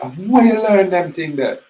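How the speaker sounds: high, loud, amazed question; shocked question. Retold or imitated. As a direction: twin brother asking in shock how he learned this. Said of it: "with a shock".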